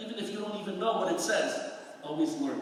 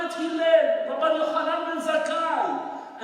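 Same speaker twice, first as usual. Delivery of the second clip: raised, higher voice, proclaiming a roll of honor; loud, fervent recitation of great names; impassioned declaration; 5 semitones higher, 5 dB louder than usual. Retold or imitated. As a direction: proclaim it proudly as the old soldier. Old soldier vows to recite names.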